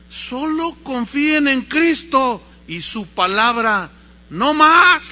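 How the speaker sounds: loud, high, slow proclamation; booming plea, drawn out; impassioned preacher's cry. Retold or imitated. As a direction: preach it out to a hall without microphones. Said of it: "Lloraba el hombre".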